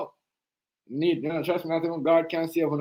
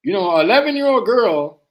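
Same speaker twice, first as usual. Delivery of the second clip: high, loud mock voice for the other side; scoffing parody of a YouTuber's claim; loud mocking quote; 8 semitones higher, 9 dB louder, slower than usual. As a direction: sneer out their words, mockingly. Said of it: "you turn around and said".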